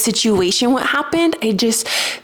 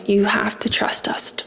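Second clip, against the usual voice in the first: whispered message. Acted. Her guides telling her to trust them, whispered. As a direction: breathe it like a voice inside her head.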